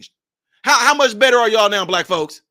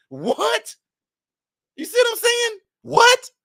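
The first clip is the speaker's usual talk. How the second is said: mock disbelief; slower than usual. Real, not acted.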